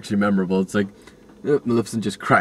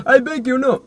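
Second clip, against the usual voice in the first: pleading king; 6 dB louder than usual. Acted. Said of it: "the king's like".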